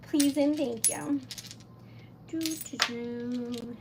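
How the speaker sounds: ordinary talk, nothing marked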